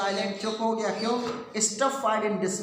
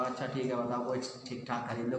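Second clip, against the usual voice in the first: father relenting; 6 semitones lower, 7 dB quieter, faster than usual. Retold or imitated. Father voiced giving in.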